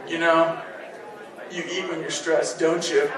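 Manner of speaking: low, soft voice from out of nowhere; disembodied voice. Retold or imitated. Dad catching his son at the freezer, low and soft. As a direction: creep in quietly from offstage. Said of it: "I hear a disembodied voice".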